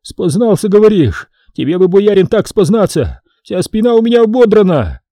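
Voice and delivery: high, loud plaintive wail; howling lament. A narrator's reading. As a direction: wail the complaint high and indignant. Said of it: "Поп опять взвыл".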